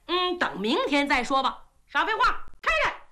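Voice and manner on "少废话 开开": furious princess ordering the guard; angry command. Acted. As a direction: lose patience and demand he open it. Princess demands box opened.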